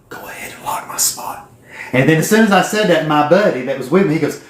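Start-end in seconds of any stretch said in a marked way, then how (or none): 0.1-1.5 breathy whisper, no voice at all. Retold or imitated. Telling the presenter to sign him up, whispered. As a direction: lean in and whisper it like a secret deal.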